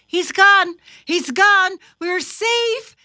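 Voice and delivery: high, loud, jubilant shouts; relieved cheering. A narrator's reading. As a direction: celebrate a narrow escape. Thumper rejoicing that danger left.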